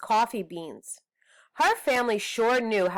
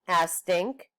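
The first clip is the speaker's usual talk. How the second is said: ordinary delivery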